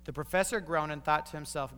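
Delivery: ordinary delivery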